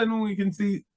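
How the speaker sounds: ordinary talk, nothing marked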